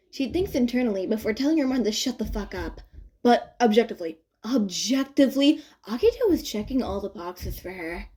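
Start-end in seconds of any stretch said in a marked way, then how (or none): none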